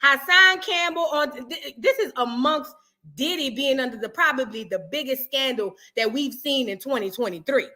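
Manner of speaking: ordinary delivery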